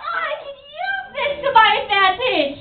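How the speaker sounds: very high, drawn-out squeal into words; gleeful character. Acted. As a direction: squeal it high, then stretch it slowly. Renge reacts to bunny in story.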